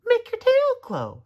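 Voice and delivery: very high, loud amazement; tiny bug exclaiming in wonder; high firefly voice. Acted. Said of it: "said the firefly".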